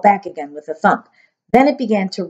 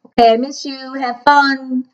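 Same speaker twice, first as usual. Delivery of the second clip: plain reading voice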